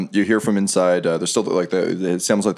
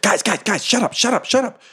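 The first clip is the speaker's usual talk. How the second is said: high, squeaky goblin voice; excited goblin; 14 semitones higher than usual. Acted. Goblin quieting the commotion among the others, high urgent voice. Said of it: "the goblin's like".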